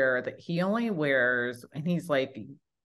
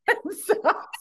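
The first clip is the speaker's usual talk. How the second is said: laughing. Real, not acted. Laughing punchline.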